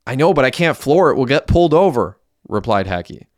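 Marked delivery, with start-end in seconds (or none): 0.1-2.2 high, agitated reply; raised voice, pushing back; urgent protest. A narrator's reading. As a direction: argue back under pressure. Driver argues under police pressure.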